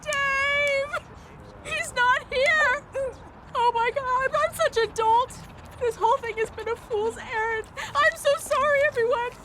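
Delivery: distraught wailing. Acted. Woman apologising for a fool's errand, distraught. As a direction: wail in misery, voice pitched high.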